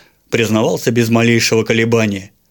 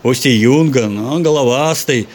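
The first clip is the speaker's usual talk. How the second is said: usual speaking voice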